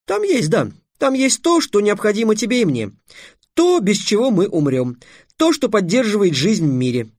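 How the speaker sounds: high-pitched, insistent answer; eager improvising. A narrator's reading. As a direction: build the riddle up excitedly.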